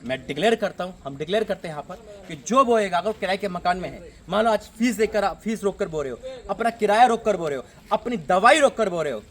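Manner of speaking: loud, insistent declaration building in repeated phrases; forceful sermon cadence; fervent preaching. Real, not acted. Declares blessing over givers.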